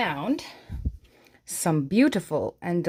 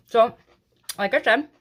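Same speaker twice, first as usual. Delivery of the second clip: talking with mouth full of cookie; 4 semitones higher, 5 dB louder than usual. Real, not acted. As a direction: speak round a mouthful. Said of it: "Sorry for talking with my mouth full".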